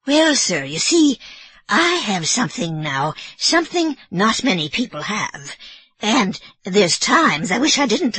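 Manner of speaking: put-on old Irish housekeeper explaining herself nervously; Mrs Leahy. Mrs Leahy confessing her gift.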